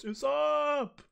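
high drawn-out cry for a crewmate; Nami. Acted. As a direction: scream the name in alarm. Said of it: "Nami goes".

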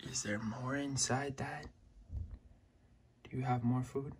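soft whispered inner voice of the lizard; softly voicing the lizard's wish; whispered pet thoughts. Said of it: "She's like".

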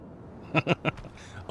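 high chuckle; real amused giggle; laugh. Real, not acted. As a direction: laugh at your own joke. Amused at own innuendo.

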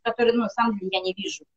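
normal talking voice

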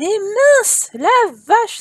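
startled, emphatic wonder; amazed exclamation. Real, not acted. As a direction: blurt it out in astonishment. Surprised at slim sim.

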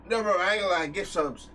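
ordinary talk, nothing marked

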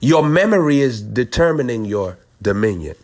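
usual speaking voice

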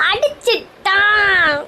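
very slow, stretched howl; drawn-out wail. Acted. Wife just hit by husband, long drawn-out wail. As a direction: drag every syllable into a long wail.

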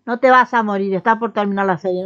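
loud alarmed protest; loud panicked scolding; alarmed outburst. Real, not acted. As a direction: cry out in alarm at the character. Alarmed at a character dying.

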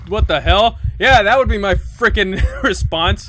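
high, loud outburst breaking into laughter; loud startled disbelief. Real, not acted. Gamer reacting to the scare, loud startled disbelief. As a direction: exclaim loudly, still rattled.

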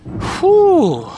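high loud exhale of relief; relieved whew. Real, not acted. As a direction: blow out a big relieved breath. Exhale after scary climb.

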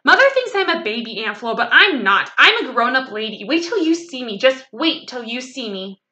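put-on excited childish boasting, easing slightly. A narrator's reading. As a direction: insist proudly like a little girl. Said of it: "very excited".

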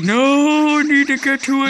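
high, loud, panicked squeal; frantic high panic. Retold or imitated. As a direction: shriek it in mock panic. Quoting his own frantic thought.

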